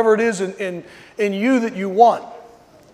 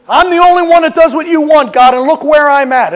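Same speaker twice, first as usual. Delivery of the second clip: Elijah's complaint; 6 semitones higher, 8 dB louder than usual. Retold or imitated.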